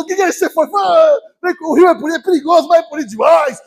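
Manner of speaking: high, loud gushing; two believers chatting excitedly; excited chatter. Retold or imitated. Excited chat between believers.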